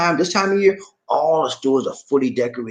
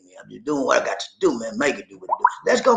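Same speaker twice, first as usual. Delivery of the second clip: normal talking voice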